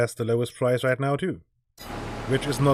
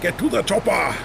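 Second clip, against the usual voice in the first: a hammed-up tough action hero bark, higher; action hero impression; 6 semitones higher than usual. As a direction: shout it like a movie strongman, half-failing. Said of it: "Doesn't, doesn't quite work".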